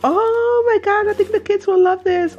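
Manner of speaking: high, excited outburst; light up with delight at the find; real high-pitched excitement; thrilled. Real, not acted. Finds a game for the kids.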